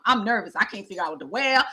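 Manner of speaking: normal talking voice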